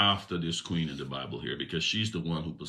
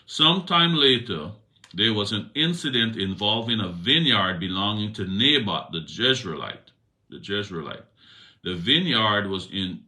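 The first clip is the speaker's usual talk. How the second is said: louder, raised Bible reading voice; scripture reading; 5 semitones higher, 7 dB louder than usual. A narrator's reading. Preacher reading First Kings to the congregation, raised reading voice. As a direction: read the passage out with weight.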